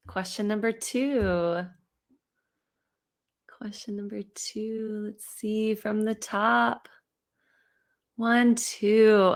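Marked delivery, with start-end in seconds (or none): 3.5-5.3 breathy whispered repeat to herself. Shown as a demonstration. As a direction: murmur it under the breath, just air. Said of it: "said plainly just before".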